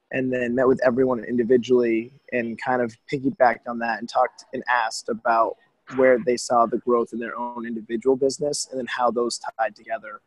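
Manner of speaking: ordinary talk, nothing marked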